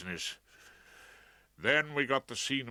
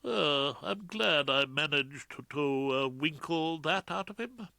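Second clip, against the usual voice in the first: smug aside; 5 semitones higher than usual. A narrator's reading. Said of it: "I heard him tell his junior".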